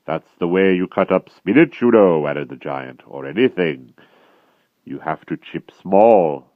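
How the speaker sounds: loud, slow, heavy giant voice; dim brutal giant. A narrator's reading. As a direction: drag each word out like a lumbering brute. Giant musing cruelly.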